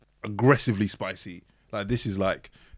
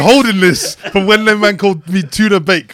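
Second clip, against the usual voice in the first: louder, higher, slow grudge; mock vexed; 9 semitones higher, 12 dB louder than usual. Real, not acted. Friend called him tuna bake, mock vexed complaint. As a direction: lay out the grudge half-joking. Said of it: "He's vexed".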